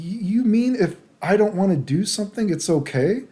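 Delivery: put-on high incredulous voice; imitated disbelief. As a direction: mimic her checking in amazement. Voices her disbelieving question.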